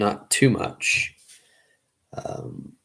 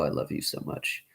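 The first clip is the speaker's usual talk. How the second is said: whispering to a dog; 6 semitones lower, 9 dB quieter than usual. Real, not acted. Cooing to the dog.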